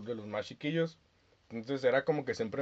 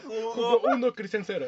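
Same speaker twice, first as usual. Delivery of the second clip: laughing mock score; 8 semitones higher, 4 dB louder, slower than usual. Real, not acted.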